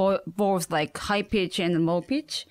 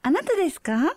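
higher, quick polite phone talk; 8 semitones higher, faster than usual. Shown as a demonstration. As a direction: keep it bright and polite. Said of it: "do your phone voice".

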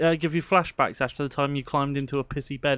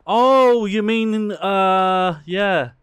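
surprised realisation; 5 semitones higher, 8 dB louder, much slower than usual. Real, not acted. Suddenly realising who is meant.

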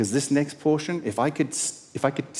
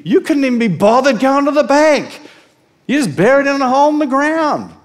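loud, much higher, mocking; laughing scorn; 10 semitones higher, 8 dB louder than usual. Master scorning the lazy servant, loud and laughing. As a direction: scoff at him with a laugh.

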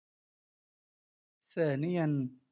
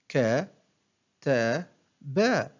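one short syllable at a time, with pauses; broken into syllables; much slower than usual. Shown as a demonstration. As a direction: separate each syllable for a learner to copy. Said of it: "أقطع الكلمة إلى مقاطع قصيرة". (تقطيع الكلمة مقطعًا مقطعًا بوقفات)

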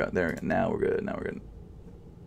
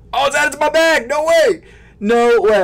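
high, loud no way; thrilled yell of disbelief; excited shout; 7 dB louder than usual. Real, not acted. Vlogger sees sneakers added to bag, high excited yell.

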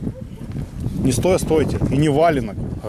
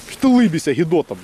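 angry challenge. Real, not acted. Man confronting a smirking opponent, aggressive. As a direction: go at him for smirking.

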